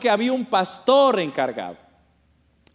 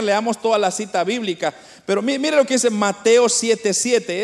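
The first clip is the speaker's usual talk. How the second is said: ordinary delivery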